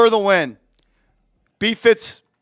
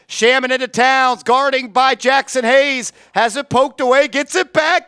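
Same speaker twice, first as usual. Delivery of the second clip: quick, lively game call; live play-by-play; 3 semitones higher than usual. Acted. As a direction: call the action as it happens. Streamer calling the game live.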